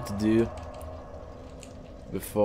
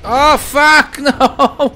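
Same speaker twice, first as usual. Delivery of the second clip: high, loud yell breaking into a laugh; laughing shout of real dismay; exasperated shout; 14 dB louder, much slower than usual. Real, not acted. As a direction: yell in disbelief, then laugh. Dying again in game.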